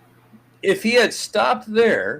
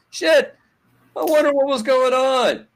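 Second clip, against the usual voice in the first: much higher, louder mock wonder; sarcastic wondering; 8 semitones higher, 6 dB louder than usual. Host mocking the Dodgers' sudden drop-off, sarcastic fake wonder. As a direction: pretend to be baffled, laying on the sarcasm.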